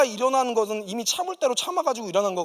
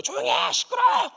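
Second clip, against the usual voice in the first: high, louder imitated yell at full force; father's shout; 4 dB louder than usual. Father rushing in, yelling at them to shut up, loud. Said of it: "막 달려 소리를 지르신 거예요".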